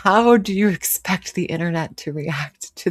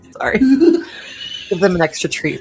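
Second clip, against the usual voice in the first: laughing. Real, not acted. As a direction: giggle through the apology. Laughing apology to viewers' pets.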